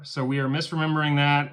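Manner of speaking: usual speaking voice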